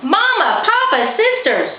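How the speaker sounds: high calling to family. A narrator's reading. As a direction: call across to them. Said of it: "she called out".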